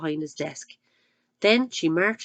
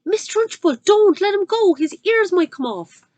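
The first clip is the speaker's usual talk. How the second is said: much higher, louder alarmed plea; cried out; 12 semitones higher, 8 dB louder than usual. Acted. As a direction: call out in alarm from the back. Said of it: "Miss Honey cried out".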